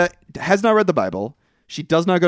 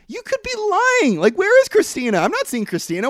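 skeptical. Retold or imitated. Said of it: "And I'm like".

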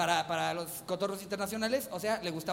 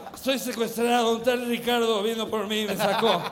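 put-on drunk voice; 2 semitones higher, 6 dB louder than usual. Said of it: "su voz de borracho".

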